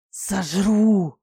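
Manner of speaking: loud, menacing dragoness growl; growled threat. A narrator's reading. Fiery dragoness diving at an insolent man, growled threat. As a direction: roar it out as a threat. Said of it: "прорычала она".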